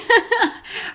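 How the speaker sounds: high burst of real laughter; amused laugh. Real, not acted. Podcast host laughing at her hiccups, amused. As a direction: giggle helplessly at your own hiccup.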